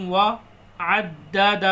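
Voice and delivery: وعدد with the dal doubled and hit hard; وعدد said slowly, dal stressed with a jerk; doubled dal with a jolt. Shown as a demonstration. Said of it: "سختی کے ساتھ پڑھیں گے اس کو، جھٹکا دے کے".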